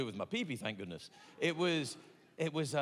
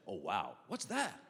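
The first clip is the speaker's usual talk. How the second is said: lower, slow laid-back doctor; deep doctor voice; 8 semitones lower, slower than usual. Retold or imitated. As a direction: voice the doctor, unbothered.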